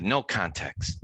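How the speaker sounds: lightly imitated drill instructor; restrained mimicry of a strict host; Jonathan. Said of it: "sound like a drill instructor".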